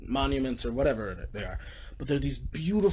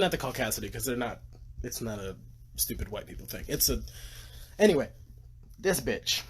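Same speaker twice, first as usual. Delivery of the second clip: ordinary talk, nothing marked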